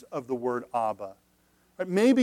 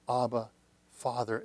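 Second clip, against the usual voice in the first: soft intimate cry; 6 semitones lower, 7 dB quieter than usual. Shown as a demonstration. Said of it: "Cry out".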